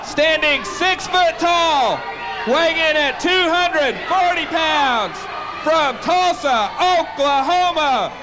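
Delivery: high, slow, drawn-out introduction; big wrestling introduction with a long-held surname; ring announcer. Real, not acted. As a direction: announce each detail in stretched phrases, climaxing on the name.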